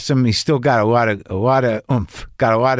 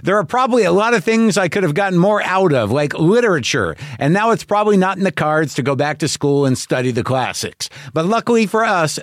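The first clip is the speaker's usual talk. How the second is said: higher, upbeat ad-read voice; bright promo read, higher pitched; 7 semitones higher than usual. Acted. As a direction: sell the podcast like a radio spot. Reads a podcast ad.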